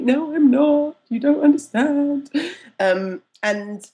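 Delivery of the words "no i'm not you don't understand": higher, louder insistent objection; mock-indignant inner plea; acted protest. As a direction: protest it, drawn out at the end. Acts out her protesting inner thought.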